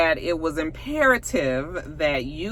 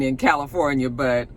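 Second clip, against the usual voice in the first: ordinary talk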